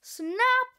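loud snap sound effect; mousetrap snap. Acted. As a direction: bark out the snap of a trap. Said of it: "That was the sound of a mouse trap".

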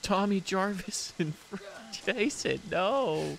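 cute anime streamer. Acted. High anime girl voice.

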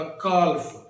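کالف read by its letters, L audible; wrong, L sounded. Shown as a demonstration. Teacher demonstrates the mistake, L voiced in کالف. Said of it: "letters کے حساب سے پڑھیں گے".

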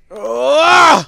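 sudden loud, high cry; startled yell. Real, not acted. Player reacting to the game, startled yell.

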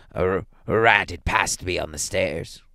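put-on stammering, shaking old man's voice; shaking tones. A narrator's reading. Old man lying to his wife about the knock, shaking tones. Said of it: "said the old man in his shaking tones".

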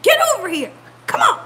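loud, high mock commands to dogs; husband's frantic warning, imitated; imitated yelling. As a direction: shout them away from danger. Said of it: "He was yelling at him too".

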